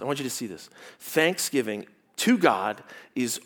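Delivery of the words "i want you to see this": softer repeat. Real, not acted.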